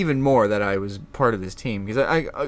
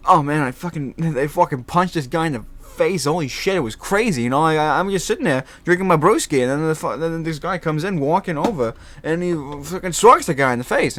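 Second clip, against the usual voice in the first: higher, louder hyped-up storytelling; showy, rambunctious witness; 6 semitones higher, 4 dB louder than usual. Retold or imitated. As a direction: sell it with wild flair. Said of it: "they liked a bit of the showmanship and the flair".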